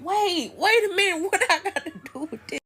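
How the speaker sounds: high, laughing disbelief; high-pitched playful objection; retold protest. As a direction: protest being dragged into it. Narrator protests being dragged in.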